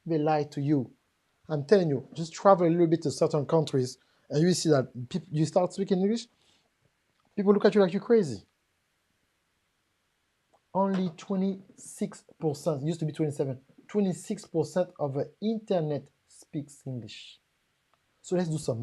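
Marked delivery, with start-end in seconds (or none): none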